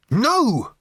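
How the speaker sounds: blurted no to stop her; near yell. Acted. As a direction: cut her off with a sharp no. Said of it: "Arthur nearly yelled".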